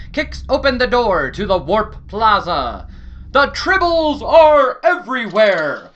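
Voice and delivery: ordinary talk, nothing marked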